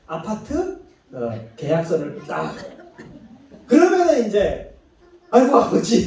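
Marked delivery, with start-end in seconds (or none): 5.3-6.1 louder, wailing cry to father; groveling son. Retold or imitated. Son seeing the apartment deed, exclaiming to father. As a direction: cry out to dad in sudden gratitude. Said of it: "하면서".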